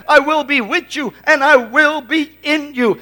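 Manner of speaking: ordinary talk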